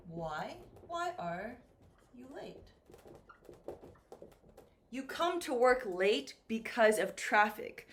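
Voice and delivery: ordinary delivery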